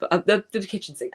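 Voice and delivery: usual speaking voice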